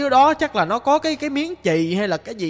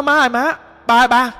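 louder imitated call to parents; retold calling out; 3 semitones higher, 5 dB louder than usual. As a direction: call out to mum and dad, as retold. Retold ghost calling his parents.

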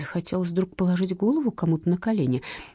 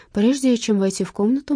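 ordinary delivery

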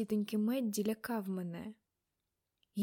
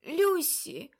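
high, loud exclamation; sudden cry; 7 semitones higher, 5 dB louder than usual. A narrator's reading. Aunt suddenly calling a name from her trance, crying out. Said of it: "раптом вигукнув хтось".

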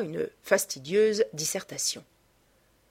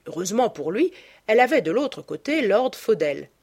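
plain reading voice

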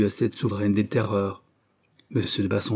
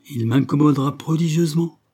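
firm, louder insistence with a higher pitch; insisting; 5 semitones higher, 5 dB louder than usual. A narrator's reading. Father refusing the suitor to his daughter, louder insistence. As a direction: dig in on the word prodigieusement. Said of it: "insista monsieur de Bassompierre".

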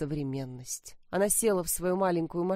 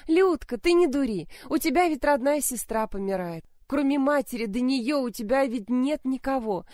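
higher, louder, thin coaxing voice; thin wheedling voice; 6 semitones higher, 4 dB louder than usual. Acted. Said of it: "Мать вдруг заюлила тоненьким голоском".

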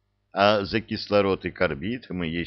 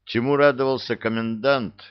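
plain reading voice

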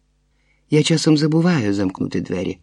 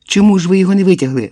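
high, loud, urgent questioning; yelling; 8 semitones higher, 5 dB louder than usual. A narrator's reading. Woman yells at men who left him in the manhole, yelling. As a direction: yell the questions, speeding up. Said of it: "горлаю я".

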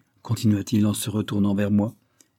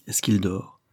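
usual speaking voice

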